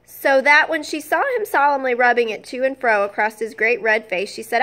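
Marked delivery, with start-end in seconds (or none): none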